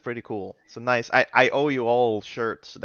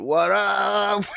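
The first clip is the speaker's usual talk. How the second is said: mock full-on greeting; 6 semitones higher than usual. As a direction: shout it like a goofy license photo. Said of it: "They just go full on".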